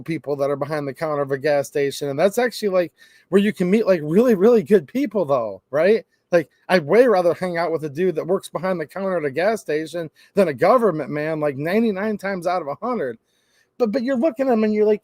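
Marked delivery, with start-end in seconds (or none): none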